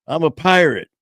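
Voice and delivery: put-on high, loud kid's boast; a kid shouting his costume; proud little pirate. Pirate boy in joke.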